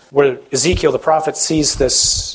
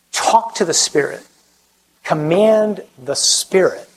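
ordinary talk

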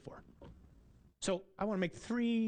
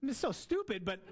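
high-pitched, through a laugh; real amused laughter; 8 semitones higher, 3 dB quieter than usual. Real, not acted. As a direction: laugh at your own silly joke as you speak. Laughing at his own joke.

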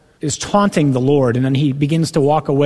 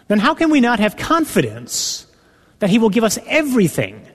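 preaching build; 6 semitones higher than usual. Acted. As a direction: let the sermon rise toward the question. Rising to preach Romans eight.

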